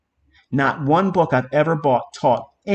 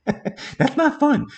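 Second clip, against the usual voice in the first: laughing; 11 semitones higher, much slower than usual. Real, not acted. Laughing about getting stuck.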